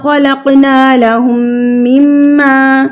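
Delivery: slow, drawn-out tajweed chant; slow melodic Quran recitation for students. Shown as a demonstration. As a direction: recite each syllable slowly and melodically.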